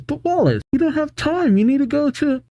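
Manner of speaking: put-on very high girlish protest; cheerleader. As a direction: plead in a squeaky cheerleader voice. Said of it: "Another cheerleader says".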